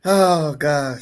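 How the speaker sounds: ordinary talk, nothing marked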